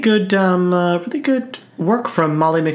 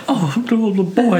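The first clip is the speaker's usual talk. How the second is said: lightly voiced old Southern accent, pitched higher; a hint of a Southern belle, raised pitch; 7 semitones higher than usual. Mocking the mother's voice, a light old Southern accent. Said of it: "like, an old Southern accent".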